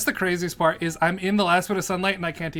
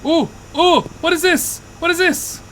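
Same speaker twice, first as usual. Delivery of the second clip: high, loud wonder; thrilled at a discovery; excited; 13 semitones higher, 10 dB louder than usual. Real, not acted. Finding a strange object.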